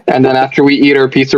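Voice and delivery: normal talking voice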